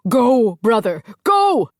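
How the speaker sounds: very high, loud urging shout; pushing his brother to flee; frantic go. A narrator's reading. Archie urging Angus away.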